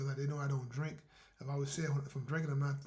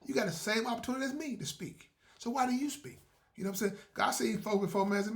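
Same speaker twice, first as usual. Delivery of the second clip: normal talking voice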